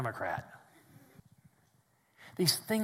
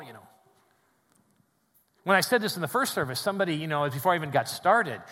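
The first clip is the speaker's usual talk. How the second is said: ordinary talk, nothing marked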